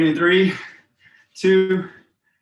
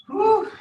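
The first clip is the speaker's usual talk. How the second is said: relieved whoop. Real, not acted. Break after hard set.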